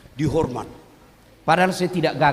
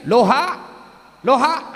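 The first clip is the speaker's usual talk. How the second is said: mock phone call; 5 semitones higher, 5 dB louder than usual. Old man's reversed phone greeting.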